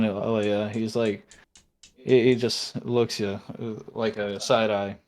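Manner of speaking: ordinary talk, nothing marked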